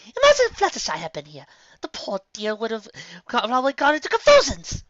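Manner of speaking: high, loud fussy lady voice; Rarity. Acted. Rarity fretting about Fluttershy, high and theatrical. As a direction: dramatize it like a fainting socialite.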